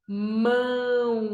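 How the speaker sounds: Mão drawn out as "mmmãããо"; one stretched syllable. Shown as a demonstration. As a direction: stretch Mão slowly without a break. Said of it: "uma sílaba". (Mão esticado numa sílaba só)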